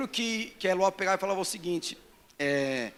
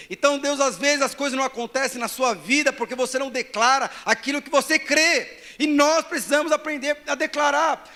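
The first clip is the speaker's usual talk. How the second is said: loud, high, slow declamation; full-force preaching; 6 semitones higher, 8 dB louder, slower than usual. Real, not acted. Preacher urging the church to declare scripture, full-force preaching. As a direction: proclaim each phrase with rising force.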